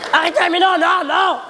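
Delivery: high shouted protest; yelling across the room. Acted. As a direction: yell it out high. (crier à travers la pièce)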